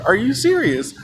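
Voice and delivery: mildly incredulous question; imitated disbelief. Embarrassed girl to the storyteller, contained incredulous question.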